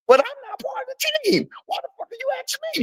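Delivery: high squeaky mimic of the friend, soft and fast; mocking whiny voice. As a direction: squeal it like a whiny kid being sarcastic. Mocking the other man's whiny voice.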